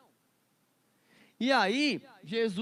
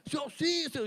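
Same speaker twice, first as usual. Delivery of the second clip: rising mock yell; 7 semitones higher than usual. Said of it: "Já subia o, o tom, né?".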